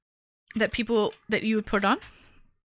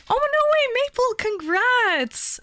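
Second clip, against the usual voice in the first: excited; 13 semitones higher, 4 dB louder, slower than usual. Real, not acted. Streak surprise for a chat member.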